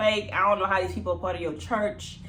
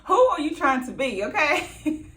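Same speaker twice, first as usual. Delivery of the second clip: laughing mockery; 8 semitones higher, 5 dB louder than usual. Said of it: "I'm like".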